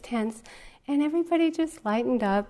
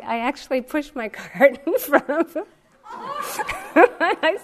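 laughing; 4 semitones higher, 3 dB louder, slower than usual. Real, not acted. Laughing while telling the story.

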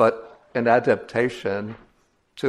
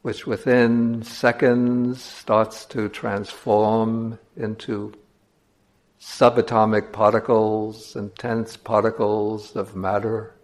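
slow, measured meditation voice with long pauses; guided meditation; slower than usual. Acted. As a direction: guide listeners slowly, leaving space between phrases.

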